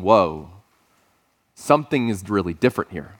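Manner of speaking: low, awed realization; hushed awe. Acted. Crowd senses Jesus's authority as he finishes, quietly awed. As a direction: drop the pitch, letting it sink in. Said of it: "the tone as he finishes is going".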